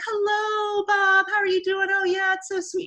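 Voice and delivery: screaming a moment ago, now all sweetness; fake sweet phone voice. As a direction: switch to an overly nice voice for the caller. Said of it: "they're acting".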